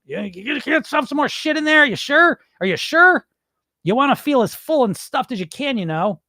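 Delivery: high, insistent mock host pressing food; pushy host voice. Mocking pushy dinner host.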